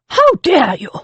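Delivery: high, loud outraged lady. A narrator's reading. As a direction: fling it at her in fury.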